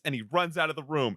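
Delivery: ordinary talk, nothing marked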